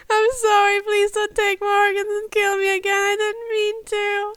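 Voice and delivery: high, tearful pleading; sobbing. Acted. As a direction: beg through sobs. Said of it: "he sobbed out".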